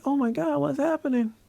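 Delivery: high-pitched imitation of panicked onlookers; mock freaking out. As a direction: squeal it like a bystander in a panic. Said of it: "everybody's freaking out".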